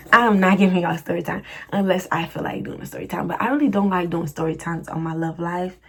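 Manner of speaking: ordinary talk, nothing marked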